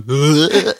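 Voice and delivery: loud, high mock retch; hammed-up loud "bleh" heave; imitated gag. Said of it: "her sister goes".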